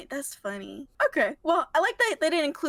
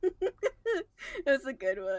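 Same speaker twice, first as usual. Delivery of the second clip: laughing through words; 3 dB quieter, much slower than usual. Real, not acted. Still laughing.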